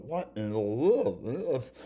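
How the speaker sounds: slow, loud, high disbelief; drawn-out baffled outburst; surprised. Retold or imitated. Tongue stuck, exasperated exclaim.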